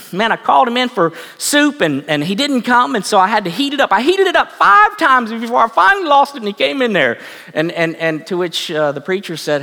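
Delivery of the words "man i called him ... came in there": frustrated mother. Retold or imitated. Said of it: "She's like".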